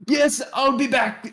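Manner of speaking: high, slow put-on character voice; high, stretched-out false start at a character; wrong character voice. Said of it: "no, that's not his voice".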